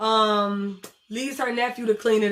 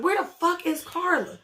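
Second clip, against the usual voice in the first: retold pissed-off demand; 7 semitones higher than usual. Daughter finds the caregiver gone, pissed off in retelling. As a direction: demand it, annoyed, pitch jumping up. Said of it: "So she really pissed".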